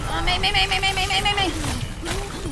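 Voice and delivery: high frantic repeated name; panicked yelling. Real, not acted. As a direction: panic as she closes in. Enemy Mei attacking him.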